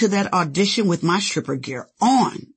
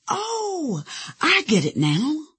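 dawning realization; 10 semitones higher, slower than usual. Retold or imitated. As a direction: let the penny drop, pitched way up. Retold moment of sudden realization.

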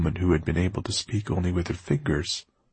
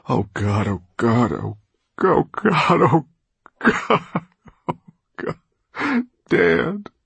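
high, breaking cries of grief; sobbing; 6 semitones higher, 6 dB louder, slower than usual. A narrator's reading. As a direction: sob out the words, voice cracking. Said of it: "Orpheus sobbed".

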